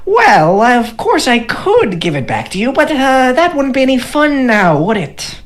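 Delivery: high, slow teasing gnome voice. Acted. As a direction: taunt like a smug little gnome. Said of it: "He goes".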